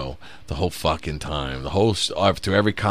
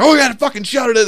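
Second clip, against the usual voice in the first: angry snap; 6 dB louder, faster than usual. Retold or imitated. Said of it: "he's like".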